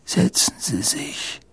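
put-on soft, weak, whispery old man; frail old headmaster. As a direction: offer it faintly, like a feeble old wizard. (schwache, leise Greisenstimme)

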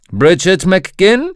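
loud, slow name call. A narrator's reading. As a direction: call the name across the room. Doctor calls out a name.

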